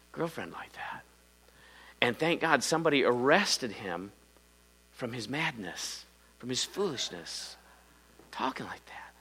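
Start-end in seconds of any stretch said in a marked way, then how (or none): 4.9-9.1 breathy whispered warning, half-teasing; whispered aside. Acted. Preacher whispering a teasing warning to the congregation. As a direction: lean in and whisper it like a secret.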